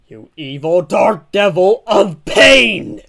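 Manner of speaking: loud, building dramatic accusation; outraged shout. Acted. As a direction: cry out at the villain, hammed-up. Hero denounces villain.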